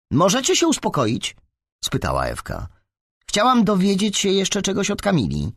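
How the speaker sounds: put-on high-pitched, impatient girl; annoyed demand. A narrator's reading. Ewka interrupts the boys' scuffle, annoyed demand.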